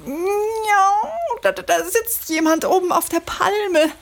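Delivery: aufgeregt. Acted. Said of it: "sagt Matze".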